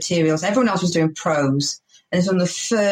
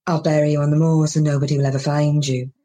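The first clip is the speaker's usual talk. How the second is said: lowered voice, even deadpan menace; deadpan threat; 4 semitones lower, faster than usual. Shown as a demonstration. Comic threatening the audience from the stage, low deadpan threat. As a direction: drop the pitch and threaten levelly. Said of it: "I lower my voice and I look deadpan".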